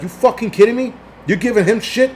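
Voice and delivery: retold indignant challenge, pitched high. Driver calling out a scooter rider through the window, indignant.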